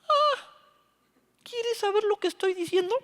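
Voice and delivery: high, bold comeback from Esteban; Esteban's high, daring reply; defiant challenge. Retold or imitated. Esteban answers his accusers.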